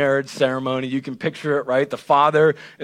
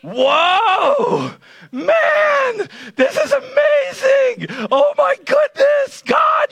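much higher, louder, slow hammed-up joyful shouting; ecstatic Adam; 3 dB louder, slower than usual. Adam seeing his bride for the first time, ecstatic mock shout. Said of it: "He says".

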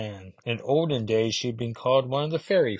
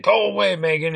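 sharp, much higher and louder angry snap; snapping; 9 semitones higher, 6 dB louder than usual. Retold or imitated. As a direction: bark him off, drunk and bitter. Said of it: "He's snapping at her".

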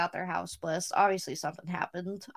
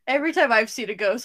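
usual speaking voice

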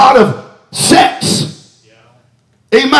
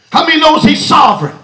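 ordinary talk, nothing marked

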